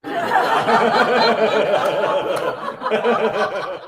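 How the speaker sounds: a high, wheezing burst of laughter; real helpless laugh. Real, not acted. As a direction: break up laughing at the joke. Laughing at his own joke.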